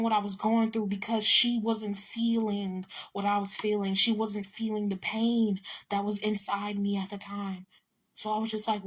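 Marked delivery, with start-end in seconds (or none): none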